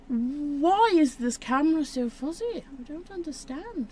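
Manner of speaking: high, rising-and-falling complaint; high-pitched bafflement. Real, not acted. Card opener complaining about a fuzzy camera, high-pitched bafflement.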